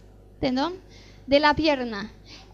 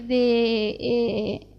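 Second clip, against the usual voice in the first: voice breaking; 5 semitones lower than usual. Real, not acted. Voice breaks while telling.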